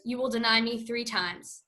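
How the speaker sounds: normal talking voice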